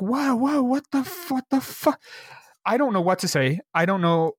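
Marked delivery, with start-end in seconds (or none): 0.0-2.0 high-pitched, retold panicked bewilderment; startled disbelief. Store clerk recalling a customer's sudden tears, startled disbelief. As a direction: act out being thrown, voice jumping high. Retelling shock at customer crying.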